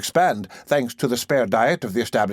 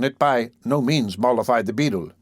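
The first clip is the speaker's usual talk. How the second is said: ordinary delivery, nothing marked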